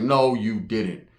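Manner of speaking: drawn-out slow "No, you didn't"; slow low mock disbelief. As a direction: stretch "No" long in mock shock. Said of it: "I was like".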